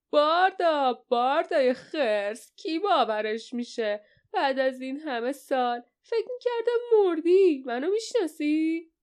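high, swooping cry of recognition; delighted shout. A narrator's reading. Small man recognising Barda after all these years, delighted shout. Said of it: "زیر خنده زد و فریاد کشید".

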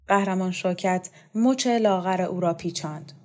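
usual speaking voice

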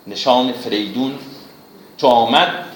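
normal talking voice

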